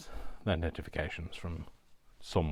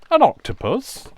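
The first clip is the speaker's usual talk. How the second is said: loud, high exclamation, imitating a friend; friend's catchphrase; 14 dB louder than usual. Crafter revives a friend's catchphrase, high loud mimicry. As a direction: squawk it out high and rising. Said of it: "a friend that used to say".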